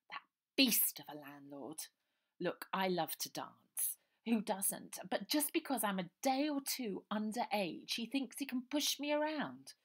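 put-on high, plummy young lady; posh voice. A narrator's reading. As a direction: speak like a spoilt posh girl. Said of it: "said the posh girl".

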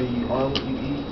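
ordinary talk

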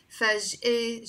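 "فجأة" ending in eh, faj'e; wrong E ending. Shown as a demonstration. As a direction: finish "فجأة" on eh in place of ah. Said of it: "I didn't say فجأة".

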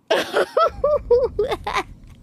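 high burst of laughter; real laughing fit. Real, not acted. Streamer who deserved that, high helpless laugh. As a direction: laugh hard at your own blunder.

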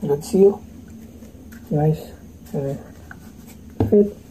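muffled behind respirator; speaking inside half mask; through a face mask. Real, not acted. Seen 0: Half-face respirator worn over mouth throughout; hands press it during seal check.

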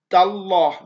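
تالله with a full, heavy dark L. Shown as a demonstration. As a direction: say تالله with the L thick and velarized. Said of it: "ما ينفعش أقول لك تلاه".

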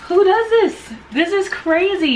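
high, amazed disbelief; can't believe someone thought of this; incredulous surprise. Real, not acted. Amazed at names on lash boxes.

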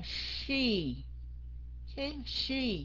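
"she" with lips pushed out as if smoking; rounded SH. Shown as a demonstration. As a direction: curl the tongue up for a long SH in "she". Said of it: "chu cái m-, miệng ra giống như hút thuốc".

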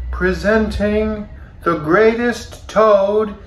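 high, drawn-out announcer from far away; dziwny głos z oddali. A narrator's reading. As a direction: call it out grandly, as from a distance. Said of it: "A strange voice from far away".

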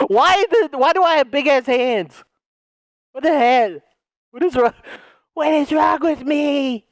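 high, incredulous cry; mock alarm. Joker discovering his huge hands, high mock alarm. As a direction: fire out baffled questions high up.

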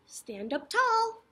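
ordinary talk

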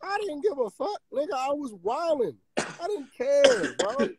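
very high-pitched, animated protest; wildly worked-up. Real, not acted. Telling friends he was wildin', worked-up and high-pitched. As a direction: let the voice leap up in excitement. Recalls wildin' over the hat.

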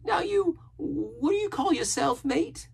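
very high, eager sailor voice; curious castaway. A narrator's reading. Castaway asking the boy's name, curious. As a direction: ask it high, rising at the end.

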